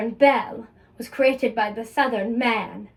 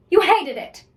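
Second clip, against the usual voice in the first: accusing; 6 semitones higher, 4 dB louder than usual. Acted. Accuses Scott.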